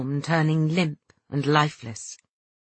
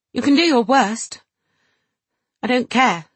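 raised, defiant and proud; stern defiance; 8 semitones higher, 5 dB louder than usual. Acted. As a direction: defy them, holding back tears. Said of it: "a stern pride controlling his tears".

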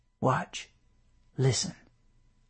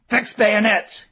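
louder, higher command; firm command; 6 semitones higher, 6 dB louder, slower than usual. A narrator's reading. Colonel commands his men to fix bayonets, firm and loud. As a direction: bark the order to the men.

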